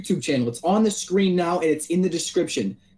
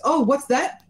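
usual speaking voice

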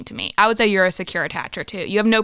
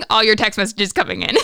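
ordinary talk

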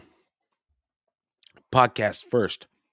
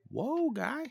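high-pitched, softer mock protest; mock taken aback; 7 dB quieter than usual. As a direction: back off in a high, startled voice. Retold startled reply to angry dad.

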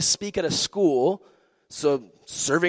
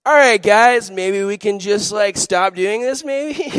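super chill; 3 semitones higher, 7 dB louder, slower than usual. Retold or imitated. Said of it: "I'm super chill".

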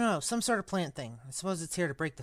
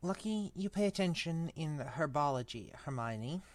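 much softer character voice; quiet relief; 3 semitones lower, 14 dB quieter than usual. Acted. Boy to friend after narrow escape, quietly relieved. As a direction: drop the volume right down, relieved.

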